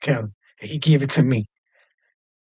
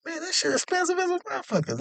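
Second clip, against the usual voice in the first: amazed exclaim; 10 dB quieter than usual. Retold or imitated. Amazed at the Dom Perignon.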